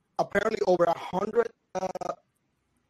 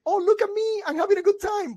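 much higher, fake carefree brightness; mock cheerful; 13 semitones higher than usual. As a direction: pretend everything is fine. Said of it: "she's trying to keep it, like, cool".

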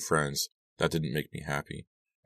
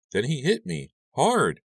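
higher, stressing the blow; shaken emphasis; 11 semitones higher, slower than usual. Acted. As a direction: push hard on hit and Hard. Victim recalls being struck.

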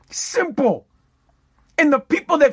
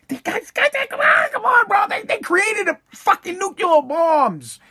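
pitched way up, yelling at the guys in disbelief; exasperated shout; 10 semitones higher than usual. Real, not acted.

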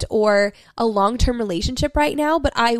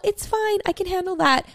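people-pleasing voice; 9 semitones higher than usual. Retold or imitated. Mocking her own people-pleasing voice.

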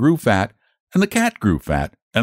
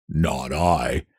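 much lower, much softer snippy no; snippy pig; 7 semitones lower, 6 dB quieter than usual. Acted. Pig snippily refusing the hen, low soft voice. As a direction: turn your back with glee. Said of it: "snippy glee".

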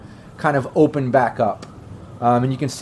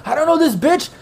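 very high-pitched outraged yell, building louder; 4 dB louder than usual. Acted. Dragged man to the security guard, yelling out in protest. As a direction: squeal in protest, getting louder each time. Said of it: "he yells at the security guard".

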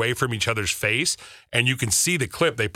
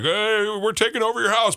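cocky crow invaders; 10 semitones higher than usual. Pretend. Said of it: "they're like".

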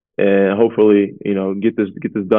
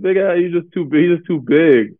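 amused teasing; 8 semitones higher than usual. Retold or imitated. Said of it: "I'm like".

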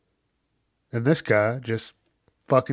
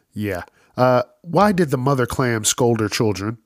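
usual speaking voice

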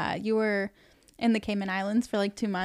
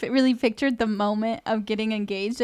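usual speaking voice

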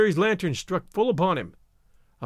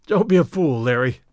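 coughing; 4 semitones lower, 5 dB louder than usual. Acted. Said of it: "I coughed at him".

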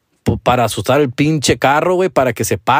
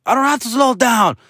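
high-pitched indignant woman's yell; 11 semitones higher than usual. Retold or imitated. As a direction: imitate an angry woman yelling from a car. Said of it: "she says something like".

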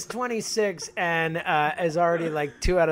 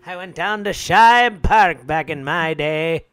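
put-on slow, louder old-timer voice; old man voice; 7 dB louder, slower than usual. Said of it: "gotta do a better old man voice than that".

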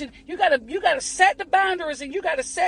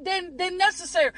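ordinary talk

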